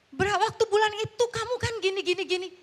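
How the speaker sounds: high, fast nagging imitation; quarrelling spouse. Spouse dredging up months-old faults in a row, mock nagging. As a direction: act out a spouse rattling off old grievances. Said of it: "Kalau ribut tuh ya".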